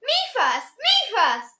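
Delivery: put-on high-pitched child's demand, not truly screamed; mock scream. A narrator's reading. Small boy pushing to be first at the door, mild mock scream. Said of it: "screamed Michael".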